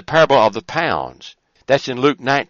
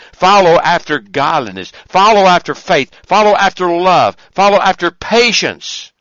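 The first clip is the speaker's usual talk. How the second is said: louder, each "Follow" hammered harder; fervent exhortation; 3 semitones higher, 6 dB louder than usual. Acted. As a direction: build the call like a preacher. Exhortation in a rising series.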